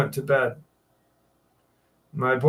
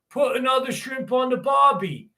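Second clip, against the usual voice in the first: Crocodile Dundee; 10 semitones higher than usual. Acted. Quoting the line Americans were given, exaggerated Australian accent. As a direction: ham up the Aussie catchphrase. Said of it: "Crocodile Dundee saying".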